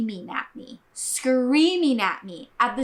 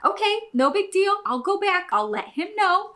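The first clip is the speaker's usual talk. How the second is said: higher, louder, accommodating reply; retold easy compliance; 9 semitones higher, 4 dB louder than usual. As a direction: act out readily giving in, pitched up. Acts out agreeing with manager's refusal.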